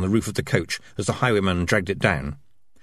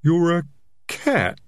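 mumbled; 9 semitones higher, slower than usual. Acted. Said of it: "he mumbled".

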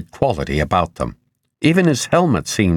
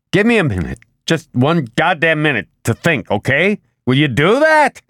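exasperated, higher-pitched demand; voice rising; 9 semitones higher than usual. A narrator's reading. Man demanding a minute to think, voice rising. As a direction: climb to a sharp, high peak at the end. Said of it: "He heard his voice rising".

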